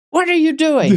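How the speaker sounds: imitating a loud, affronted customer; retold loud challenge. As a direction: blurt it at a stranger, pitched well up. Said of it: "really loudly, he says".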